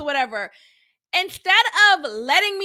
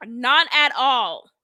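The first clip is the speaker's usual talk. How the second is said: ordinary delivery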